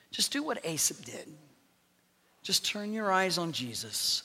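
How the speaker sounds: ordinary talk, nothing marked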